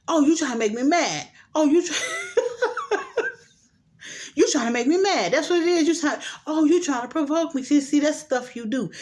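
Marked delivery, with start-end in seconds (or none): 0.1-2.0 mocking accusation. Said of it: "He was like". 2.0-3.3 a very high, breaking laugh. Real, not acted. Vlogger breaks into a high laugh while mimicking him. 4.3-7.6 mocking accusation. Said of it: "He was like".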